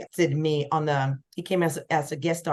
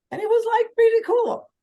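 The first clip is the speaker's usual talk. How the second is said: much higher, louder gush of delight; delighted amazement; 5 dB louder than usual. Real, not acted. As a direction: gush about how cool it was. Marvelling at the stranger's rap.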